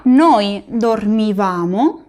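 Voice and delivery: "dormivamo" slow, VA drawn out; teacher's slow model, dormiVAmo; stress on va. Shown as a demonstration. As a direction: lean long on the VA of "dormivamo". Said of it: "la sílaba tónica como siempre es va".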